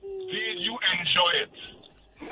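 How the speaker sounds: drawn-out suggestive question; slow mocking question. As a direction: stretch it slowly and knowingly. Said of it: "The worst question is".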